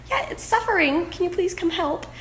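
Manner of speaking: high-pitched plea for help; plead, voice jumping up high. Retold or imitated.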